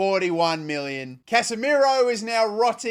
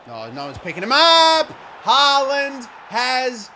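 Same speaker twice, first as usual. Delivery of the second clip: louder, urgent game call; alarmed commentary; 4 semitones higher, 6 dB louder than usual. Real, not acted. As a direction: call it out as the goal goes in. Haaland scores against him.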